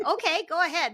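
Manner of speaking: light, high, breezy dismissal; mock breezy okay. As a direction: toss it off like you don't care. Therapist mimics own reply.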